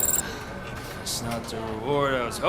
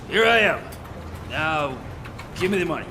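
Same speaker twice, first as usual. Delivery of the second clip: demanding; 4 semitones higher, 6 dB louder than usual. Acted. Criminal demanding reward money.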